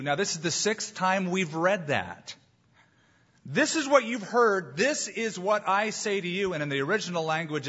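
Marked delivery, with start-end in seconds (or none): 3.4-6.5 "This is what I say to you" pitched high with firm emphasis; high, emphatic quote with weight on "This" and "I". Acted. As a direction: set "This is what you've heard" high and deliberate against "I say to you". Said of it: "it's very emphatic".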